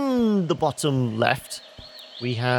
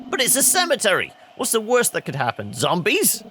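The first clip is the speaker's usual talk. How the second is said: incredulous protest, pitched up at the start; lightly mocking kid, rising on the last word; cheeky kid; 8 semitones higher than usual. Kid arguing with the cemetery guard, scoffing.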